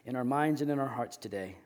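low, soft closing prayer. Real, not acted. Pastor closing a prayer before the sermon, hushed prayer.

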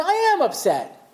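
high-pitched mock indignation, retold; a retold flash of hurt anger, pitched high; upset. Yaakov answers Leah, voicing mild mock upset. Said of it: "You look kind of upset".

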